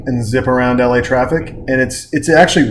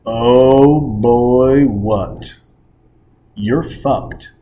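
smug clerk; 3 semitones lower, much slower than usual. Retold or imitated. The clerk reenacted gloating at the stranded customer, low. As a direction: savour the bad news.

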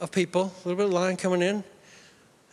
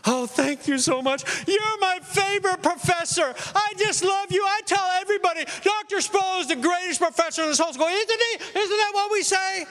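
hammed-up high shouting gush of thanks; gushing flattery; 11 semitones higher than usual. Students flattering their professor after the extension, hammed-up gush.

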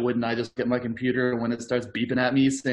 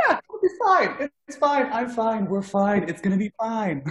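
high put-on woman's voice sliding down; girly voice; 6 semitones higher than usual. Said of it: "the female Randy".

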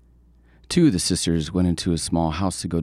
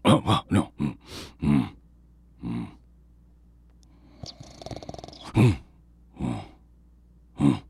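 drowsy mumbling hums; nodding off mid-hum; sleepy humming; slower than usual. A narrator's reading. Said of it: "her head began to nod".

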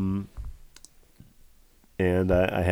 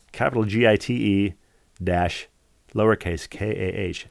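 ordinary talk, nothing marked

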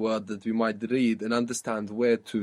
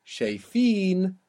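شايفين with the last syllable long, higher and stressed; stress on final long vowel; 7 semitones higher, 3 dB louder than usual. Shown as a demonstration. Teacher showing learners the plural, stress on the last syllable of شايفين. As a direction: draw out and lift the "een" ending of شايفين. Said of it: "it takes the accent".

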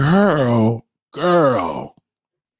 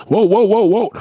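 loud, higher whoa to halt the bit; loud stop; 8 semitones higher, 6 dB louder than usual. Real, not acted. Comedian halting his own sketch with a loud whoa. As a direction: cut yourself off loudly. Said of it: "let me stop".

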